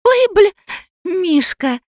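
put-on very high, gleeful child voice; happy little girl. A narrator's reading. Girl copies grandmother's swearing, high happy exclamation. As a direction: exclaim with innocent delight, pitched right up. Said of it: "счастливо заявила она".

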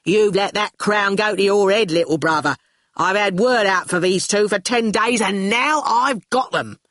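bossy big-sister put-down; Shine scornful. Acted. Shine refuses Torquil's demand, scornful. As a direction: put a little brother in his place. Shine scolds her little brother.

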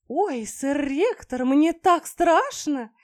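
put-on high, slow, coy girlish voice; innocent babbling. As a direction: play fake-innocent and coy. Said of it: "невинно пролепетала".

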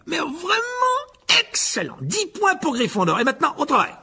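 high, bright-eyed praise, slowing at first; beaming exclamation. A narrator's reading. Lockhart beaming at his pupils, high excited praise. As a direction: gush with delight at the class. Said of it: "s'exclama Lockhart avec un sourire radieux".

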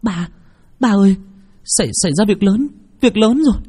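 a daughter rushing to tell her father; frightened stammer; panicked. Acted. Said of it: "Nguyệt hốt hoảng".

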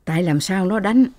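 usual speaking voice

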